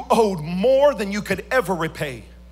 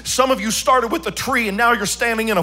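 loud, half-sung preaching build; full-force preaching; 2 semitones higher, 6 dB louder than usual. Acted. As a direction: preach it out over the music, singing the lines. Preaching about bitterness and wasps.

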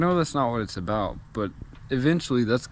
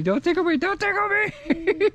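much higher, laughing while tickled; being tickled. Real, not acted.

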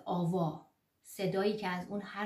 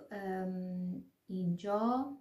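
usual speaking voice

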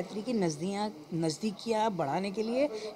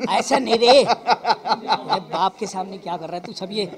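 loud, laughing father scolds; mock scold as the father; playful scolding father; 8 dB louder than usual. Father scolding, playing role.

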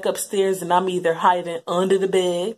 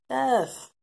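usual speaking voice

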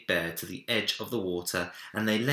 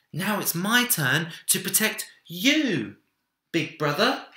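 put-on high, louder child's declaration; brave little boy; 10 semitones higher, 5 dB louder than usual. A narrator's reading. Minik shields Big Bear from the hunter, high and brave. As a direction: stand tall and say it like a brave boy. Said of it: "he said".

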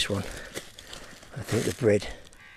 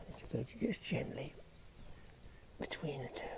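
very soft, higher, careful whisper; hushed whisper; 4 semitones higher, 14 dB quieter than usual. Real, not acted. Angler lowering bait between two fish, whispering. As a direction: whisper it as you ease the bait in.

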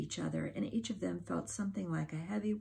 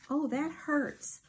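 high, drawn-out cry of pain; 10 semitones higher, 4 dB louder, slower than usual. A narrator's reading. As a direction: wail that it hurts. Bitten awake on the toe.

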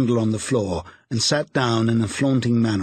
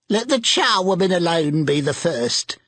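higher excited charwoman cry; a charwoman crying out gleefully; cried out by the charwoman; 6 semitones higher than usual. A narrator's reading. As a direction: crow it out like a bold old charwoman. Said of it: "cried she who had entered first".

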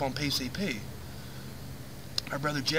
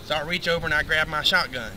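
ordinary delivery

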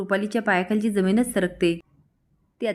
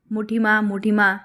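narrated call, a little louder; 3 dB louder, slower than usual. A narrator's reading. Amol calls to his aunt behind a shut door, calling out. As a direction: call the name twice, mildly raised. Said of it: "तो आता आवाज देतो".

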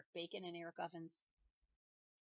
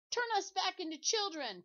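much higher, louder command; insist, no room to refuse; demanding; 11 semitones higher, 9 dB louder than usual. Acted. Todd demands the teacher undo the spell, loud. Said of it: "Todd demanded".

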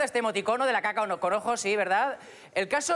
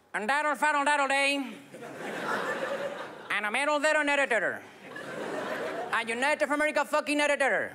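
George Bush mumbling; 4 semitones higher than usual. Retold or imitated. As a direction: mumble fake English like a drunk Bush. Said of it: "un meeting de George Bush".

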